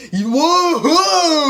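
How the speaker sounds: loud, high whoop; mock cheer. Celebrating a newly invented slang word, mock cheer.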